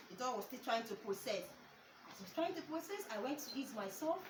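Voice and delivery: normal talking voice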